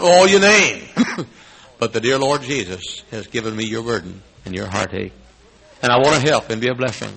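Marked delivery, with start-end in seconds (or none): none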